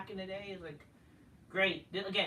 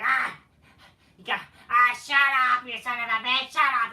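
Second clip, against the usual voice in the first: loud, raised-pitch hammed-up yelling; mock angry yelling; 3 semitones higher, 10 dB louder than usual. Wrestler telling someone to shut up, mock furious yell. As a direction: bellow it like an over-the-top wrestler. Acted outburst mocking Raw's talk.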